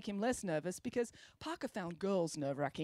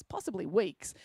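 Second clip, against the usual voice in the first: ordinary delivery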